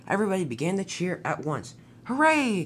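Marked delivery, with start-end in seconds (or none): 2.0-2.6 high, drawn-out hooray; cheering call. A narrator's reading. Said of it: "called Oliver".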